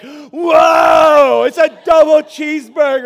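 high, loud, over-the-top whoa; mock amazed shout. Pastor shows the crowd how to react, shouting in amazement. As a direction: gush like the double rainbow guy. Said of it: "I better hear some like".

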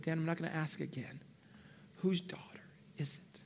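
last gentle warning from God. Retold or imitated. God asks a final time.

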